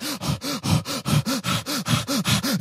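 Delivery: long high desperate gasp for air. Shown as a demonstration. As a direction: gasp like a thirsty deer. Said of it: "it starts".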